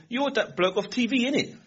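high-pitched youth accosting a celebrity; mock youth-speak, high questioning "innit"; young bloke's slang. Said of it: "you know, they talk, young people".

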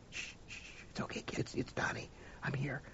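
put-on whispered hush to a hurt child; soothing whisper. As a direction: hush him gently, all breath. Comforting beaten boy.

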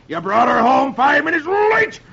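high, hammed-up furious father; angry roar. A narrator's reading. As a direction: roar at the boy on the doorstep. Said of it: "grabs him by the nose and roars".

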